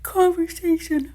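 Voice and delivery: word stretched high through a yawn. Real, not acted. Mid-sentence to viewers, yawning through the word. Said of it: "let me know in the comments if you yawned".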